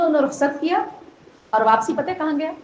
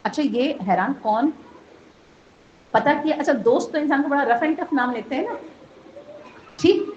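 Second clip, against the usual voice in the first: normal talking voice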